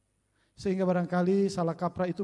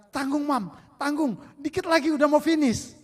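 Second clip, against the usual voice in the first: put-on busy kid; 8 semitones higher, 6 dB louder than usual. Child answering mother, gaming.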